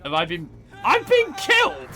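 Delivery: high, loud hammed-up call for help; theatrical panic as his man goes down; mock cry for help. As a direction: yelp it out like a dying soldier, half joking. Character dies in battle, calling out.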